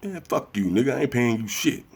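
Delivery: high mocking brush-off; dismissive debtor. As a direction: blow him off like a deadbeat. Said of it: "And I be like".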